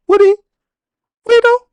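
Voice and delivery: "Will he though?" in a very high, squeaky mock voice; squeaky disbelief. Real, not acted. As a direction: push the doubt up into a squeak. Pitch climbs to a squeak.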